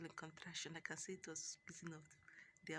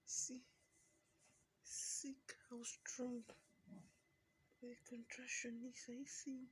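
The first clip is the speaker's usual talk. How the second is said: high, breathy whisper through pain; strained whisper; 7 semitones higher, slower than usual. Real, not acted. Mother in labour showing the camera her pain. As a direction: whisper it while the contraction peaks.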